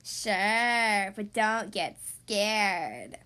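slow, low creature rasp; raspy monster. A narrator's reading. Monster warns the kid before showing its tail, low slow rasp.

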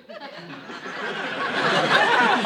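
high breathy nervous laugh. Acted. Riley laughing nervously at losing his job. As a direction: laugh it off in dawning dread. Riley realizes he'll be fired.

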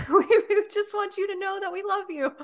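half-laughing fake sob; hammed-up crying plea to the author; mock tearful. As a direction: plead through a teary laugh.

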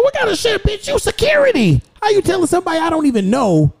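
high, squeaky outrage through laughter; laughing disbelief. Retold or imitated. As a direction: squeal it out while cracking up. Retelling outburst at the security guard.

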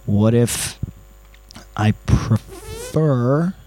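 halting, stretched-out question, rising at the end; retold cautious, wincing test question. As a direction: drag out "prefer" as if bracing for a blow. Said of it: "and I, and I winced".

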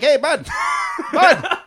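high, loud calls to a passed-out guy; rising calls of bud, laughing; mock loud hail. Trying to wake the guy on the floor, hollering. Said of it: "I'm like".

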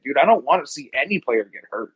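normal talking voice

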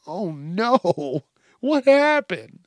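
high, slow, laughing gasp of shock; mock shocked. Imagining a reaction to the fat remark, high mock shock. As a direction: stretch it out in mock horror. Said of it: "You'd be like".

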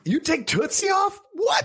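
high-pitched, disbelieving outburst; incredulous. Real, not acted. Host challenges co-host's pick of Tootsie, incredulous. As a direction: question it in real shock, pitch leaping up.

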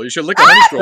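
loud, high-pitched gleeful urging; excited shout. Real, not acted. Guest egging host on with a joke, excited shout. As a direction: shout it up high as a brilliant idea.

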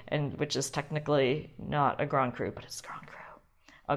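unvoiced conspiratorial whisper. Real, not acted. Host confiding to viewers about the Grand Cru, whisper. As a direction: whisper it like a secret.

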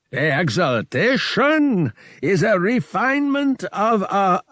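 proud alien lecturing; alien priest voice. Acted. Teroenza explains mating hum.

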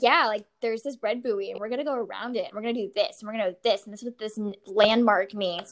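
fast, run-on retelling of a brief; confident bluffing. Retold or imitated. Trainee briefing a navigation instructor, confidently bluffing. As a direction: rattle it off as if sure of every word. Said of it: "you said it so confidently".

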